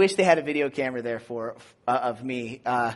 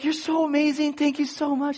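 gushing thanks; 10 semitones higher than usual. Retold or imitated. Drugged gushing at nurse.

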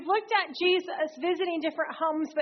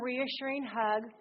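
usual speaking voice

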